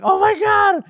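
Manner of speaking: very high, loud exclamation; acted-out astonished squeal; starstruck shout. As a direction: cry out in awed shock. Acted reaction on seeing his idol.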